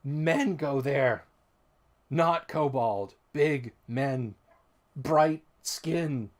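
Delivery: a hint of an eager kobold fawning on men. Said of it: "he says".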